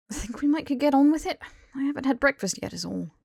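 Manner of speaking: lightly voiced impatient Harry. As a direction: nudge him along impatiently.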